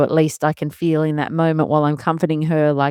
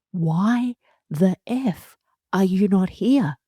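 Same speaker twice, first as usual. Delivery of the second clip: put-on higher, slow pleading child's voice; voicing the scared child; 7 semitones higher, slower than usual. Mother voicing her daughter dangling from play equipment, high and slow. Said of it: "see the look of".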